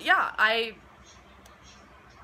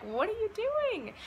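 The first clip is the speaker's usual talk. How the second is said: much higher and slow, startled retold question; high-pitched disbelieving "what are you doing"; alarmed question; 14 semitones higher than usual. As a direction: recall the panic at the needles coming in. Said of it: "and I'm like".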